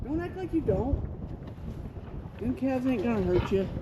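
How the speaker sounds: ordinary talk, nothing marked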